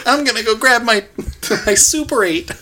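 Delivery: high, loud hammed-up voice; overacted movie-dad tone; mock melodrama. Mock dad and son scene.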